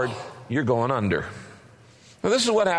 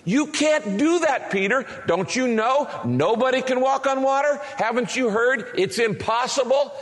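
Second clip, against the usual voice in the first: scoffing heckler; 6 semitones higher than usual. Retold or imitated. Doubters heckle Peter.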